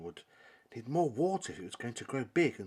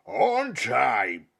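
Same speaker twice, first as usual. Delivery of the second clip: slow, drawn-out giant bellow, much louder; bellowing monster; 4 semitones higher, 12 dB louder, much slower than usual. Acted. Fossifu boasting to nobody, slow booming bellow. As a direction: stretch every word like a boastful giant. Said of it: "he bellowed".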